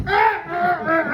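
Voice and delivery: short mock cries of pain; hammed-up agony yelps. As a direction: yelp like a man under the saw. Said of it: "He starts going".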